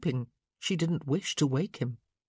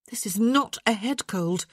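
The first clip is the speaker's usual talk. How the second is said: sharp; 5 semitones higher, 3 dB louder than usual. Acted. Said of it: "she said sharply".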